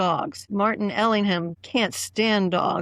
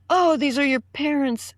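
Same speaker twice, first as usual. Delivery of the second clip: higher, slow, gushing mock friend; lightly voiced eager Mark; a hint of an overeager Mark Milo; 7 semitones higher, slower than usual. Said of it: "Mark Milo was there saying".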